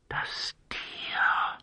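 hushed, frightened whisper; voiceless, anxious whisper; fearful whisper. A narrator's reading. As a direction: whisper it in dread. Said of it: "flüsterte der Mann in Schwarz ängstlich".

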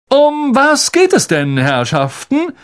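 high, grand showman voice; pompous teacher. Acted. Lockhart greets the students, pompous and grand. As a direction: sweep in like a vain celebrity.

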